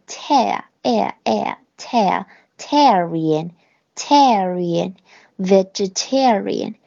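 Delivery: "tar" and "air" said apart, slowly joined into "tarian", then "vegetarian"; slow it down and glue "tar" to "air" before saying "vegetarian"; syllable-by-syllable drill. Shown as a demonstration. Teacher breaking down a word for students, slow and deliberate. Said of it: "那块读tar".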